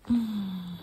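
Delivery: ordinary delivery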